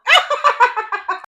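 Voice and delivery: a high, bright burst of laughter; delighted laugh. Real, not acted. Grandson calls her the star, she laughs loudly. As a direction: laugh out loud, tickled by the compliment.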